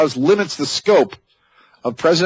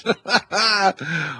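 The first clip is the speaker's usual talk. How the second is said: laughing; 4 semitones higher, 4 dB quieter than usual. Real, not acted. Laughs at the quote.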